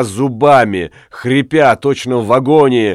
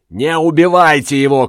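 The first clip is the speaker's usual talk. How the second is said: higher-pitched shouted plea; narrated woman's impassioned cry; 5 semitones higher than usual. A narrator's reading. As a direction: cry it out urgently. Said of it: "кричала Тория".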